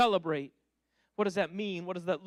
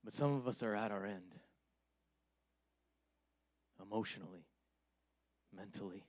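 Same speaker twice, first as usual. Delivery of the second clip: much softer, lower, intimate appeal; soft earnest preaching; 8 semitones lower, 10 dB quieter than usual. Acted. Preacher speaking softly to the weary, tender and low. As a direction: lean in and speak gently to hurting listeners.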